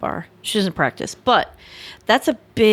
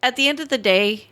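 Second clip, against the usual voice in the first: usual speaking voice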